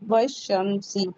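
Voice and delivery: वशमसी drawn out, w joined straight to sh; slow demo. Shown as a demonstration. Teacher to students, slowly modelling वशमसी. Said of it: "वाव को शीन से मिला दे रहे हैं".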